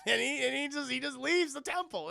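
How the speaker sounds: ordinary talk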